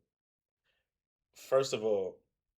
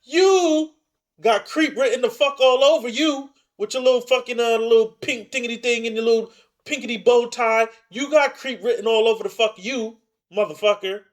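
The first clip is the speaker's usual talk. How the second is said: high, very loud comic tirade; mock outraged shout; 12 semitones higher, 12 dB louder than usual. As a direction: holler insults at him, stretching the opening words. Mock tirade at pastor on screen.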